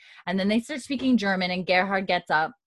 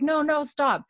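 higher pleading no; 8 semitones higher than usual. Retold or imitated. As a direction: plead with them to stop, pitch up. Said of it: "She's like".

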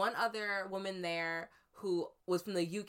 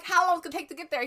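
panicked rush; 9 semitones higher, 8 dB louder, much faster than usual. Retold or imitated. Panicked taxi question, rushed.

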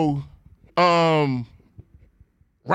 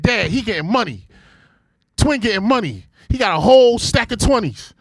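higher, building hype over the cash; mock wide-eyed kid, louder at end; imitating bragging son; 5 semitones higher, 4 dB louder than usual. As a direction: pile the excitement on. Son bragging about coworker.

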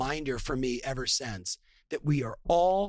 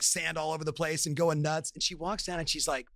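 ordinary talk, nothing marked

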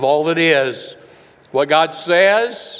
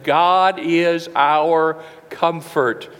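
drawn out very slowly, word by word; slow and weighty; much slower than usual. Real, not acted. Preacher gives the congregation his foundational message, very slowly. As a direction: stretch each word of the key message.

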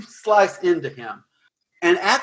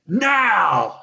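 sudden sharp call, pitched higher; a villain giving the signal to strike; a mild hammed-up orc shout of command; sorcerer's command; 4 semitones higher than usual. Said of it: "had said".

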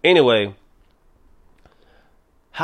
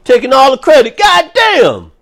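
fast, higher, louder griping that peaks on God damn; fed-up coronavirus; 9 semitones higher, 7 dB louder than usual. Pretend. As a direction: complain like the slighted virus. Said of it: "this motherfucker was like".

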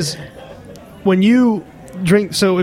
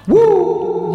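excited whoop; 13 semitones higher than usual. Real, not acted. Excited exclamation about wine.

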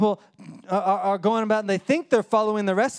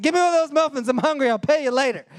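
hurried mom; 8 semitones higher, 4 dB louder, faster than usual. Retold or imitated. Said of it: "so she's like".

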